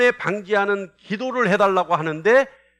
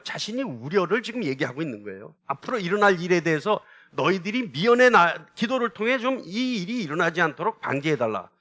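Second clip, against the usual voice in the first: ordinary talk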